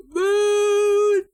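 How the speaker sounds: very loud, very high squeal; high-pitched shriek. Real, not acted. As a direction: scream it with delight. Excited exclamation.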